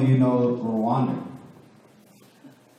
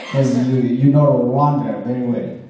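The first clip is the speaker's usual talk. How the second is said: laughing through the words, louder; 8 dB louder than usual. Real, not acted. Speaker to the Bible class, teasing, laughing. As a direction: chuckle while teasing the room.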